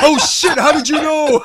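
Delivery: loud, high laughing surprise. Real, not acted. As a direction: laugh out the question loudly.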